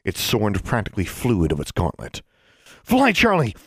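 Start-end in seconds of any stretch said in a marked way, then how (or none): 2.8-3.5 high loud urgent yell. A narrator's reading. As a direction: shout it up to the sky. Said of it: "Alexandra yelled".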